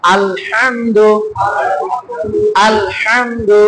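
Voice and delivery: slow drawn-out "আলহামদু" with mid-throat ha; pressed throat ha. Shown as a demonstration. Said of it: "মাঝখানে চাপ দিবেন".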